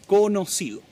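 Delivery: ordinary talk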